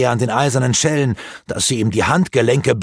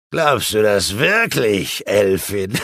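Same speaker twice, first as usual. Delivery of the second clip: scheming sorcerer; slower than usual. Acted. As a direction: savour every word like a villain confiding his plot. Rurak's villain voice.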